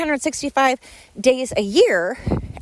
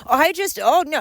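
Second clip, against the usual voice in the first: higher, flustered backpedal; mocking the partner; 7 semitones higher than usual. Imitating the partner asked why he said it, mock flustered. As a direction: mimic him stumbling to take it back.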